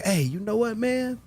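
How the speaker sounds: imitated eager invitation. Host inviting a friend onto the show, imitated eager high voice. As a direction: imitate someone floating an idea, voice jumping high.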